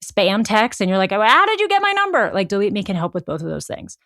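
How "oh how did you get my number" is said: high, loud mock bafflement; mock surprise. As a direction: play it like a spam text just caught you off guard. Mock puzzled reaction to spam text.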